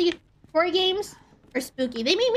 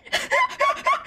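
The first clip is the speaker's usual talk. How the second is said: shrill panicked laugh, much higher; startled laugh. Real, not acted. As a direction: laugh out of sudden fright. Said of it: "scared me".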